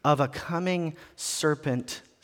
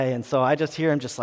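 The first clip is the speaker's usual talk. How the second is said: ordinary delivery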